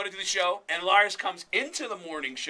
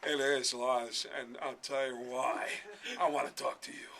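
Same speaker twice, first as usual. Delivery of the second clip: Christopher Walken; 3 semitones lower than usual. Acted. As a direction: do a halting, deadpan celebrity impersonation. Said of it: "Christopher Walken is next door".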